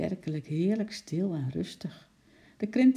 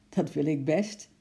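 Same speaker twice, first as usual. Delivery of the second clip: ordinary delivery, nothing marked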